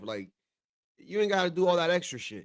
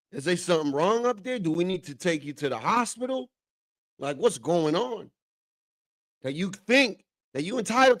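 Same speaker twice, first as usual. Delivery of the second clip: raised, higher-pitched mock telling-off; acted indignant scolding; 4 semitones higher, 3 dB louder than usual. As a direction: act out a parent chewing out a cheeky kid. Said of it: "And I'd be like".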